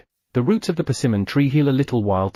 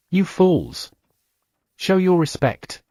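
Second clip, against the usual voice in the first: higher, sharp scolding shout at the troops; angry reprimand; 6 semitones higher, slower than usual. A narrator's reading. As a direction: bark it at the kneeling soldiers. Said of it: "Lucan shouted at the soldiers".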